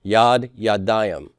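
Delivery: yadayim said slowly, weight on the ay of "yadayim"; teacher models "yadayim" with stress on ay. Shown as a demonstration. Teacher demonstrating stress on the ay of "yadayim". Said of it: "I'm stressing the I in ayim".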